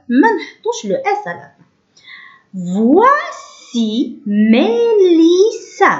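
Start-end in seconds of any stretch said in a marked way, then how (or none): 2.5-5.9 very slow, higher, every syllable stretched. Shown as a demonstration. Teacher reading French to young pupils, syllable by syllable. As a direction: draw out each syllable of Voici Mélissa. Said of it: "قلنا تنطق وا".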